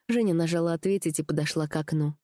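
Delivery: ordinary delivery, nothing marked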